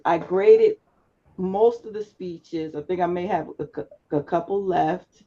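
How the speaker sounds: ordinary talk, nothing marked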